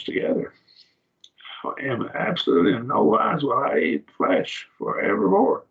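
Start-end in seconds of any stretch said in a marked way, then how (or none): 1.6-5.6 high-pitched, insistent vow as Paul; emphatic refusal. Acted. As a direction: declare it as an absolute, pitched well up. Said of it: "absolutely negative".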